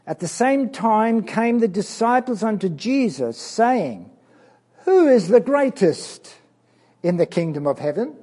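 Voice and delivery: loud, emphatic Bible reading; reading the Bible aloud with force; scripture reading. Acted. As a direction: proclaim the verse to the children.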